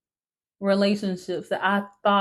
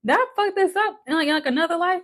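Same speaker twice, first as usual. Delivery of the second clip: incredulous; 9 semitones higher, faster than usual. Retold or imitated. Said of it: "I'm like".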